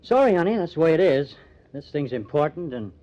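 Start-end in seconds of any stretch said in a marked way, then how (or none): 0.0-1.4 louder and higher, shutting the matter down; firm loud refusal. Acted. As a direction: put your foot down, loud and final. Cutting off his girlfriend's plea.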